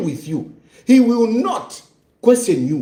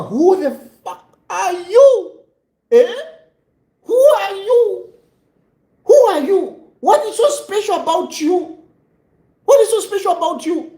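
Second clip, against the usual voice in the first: scornful higher-pitched rebuke with a laugh; indignant scolding; 8 semitones higher than usual. Real, not acted. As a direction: demand who he thinks he is, half laughing. Scolding the jailed politician directly.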